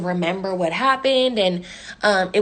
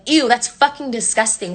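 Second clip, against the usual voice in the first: mock disgust. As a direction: imitate a friend's disgusted reaction. Friend mocking her food.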